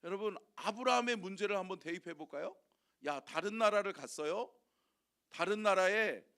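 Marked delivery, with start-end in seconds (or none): none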